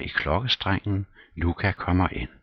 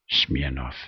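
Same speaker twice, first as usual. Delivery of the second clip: plain reading voice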